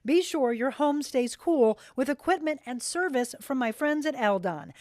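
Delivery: smooth, upbeat sponsor read; radio ad read. Acted. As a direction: sell it to the listener. Reads a sponsor ad.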